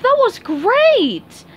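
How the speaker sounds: high, loud and slow cheer; yelled excitedly. Acted. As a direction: cheer it out with delight. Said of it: "yelled Sandy".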